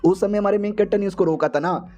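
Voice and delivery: usual speaking voice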